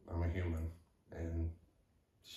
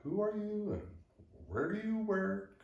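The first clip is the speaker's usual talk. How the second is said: high, puzzled questioning; confused office worker. Retold or imitated. Office staff who don't recognize the caller, high puzzled questions. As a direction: ask it baffled, pitched high.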